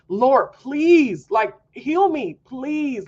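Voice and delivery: high, loud pleading cry to God; retold plea with the voice lifted and pressing; desperate begging prayer. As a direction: beg the Lord to heal you. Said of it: "Like, I was begging him".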